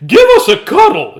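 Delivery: very high, loud shout; crying out. A narrator's reading. Big man demanding a cuddle, high comic shout. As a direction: bellow it excitedly in a silly high voice. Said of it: "he cried".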